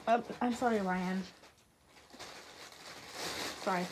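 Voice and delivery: soft, faltering apology; tearful apology. A narrator's reading. Michael apologizing to Y N, softly contrite.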